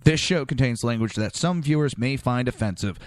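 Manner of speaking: ordinary talk, nothing marked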